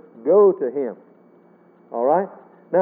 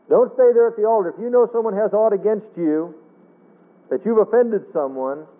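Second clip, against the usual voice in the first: ordinary talk, nothing marked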